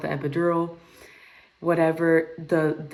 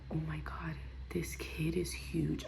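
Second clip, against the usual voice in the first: awed whisper; 13 dB quieter than usual. Retold or imitated. Stunned room seeing the baby, hushed whisper. Voicing the stunned room's reaction.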